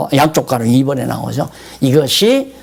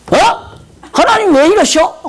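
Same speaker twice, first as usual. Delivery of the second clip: much higher, louder shocked outcry; shocked protest; 14 semitones higher, 3 dB louder than usual. Retold or imitated. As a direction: cry out at God, stunned. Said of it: "원로 목사가 이유도 없이 교회에서 다 쫓겨났어". (깜짝 놀란 항의)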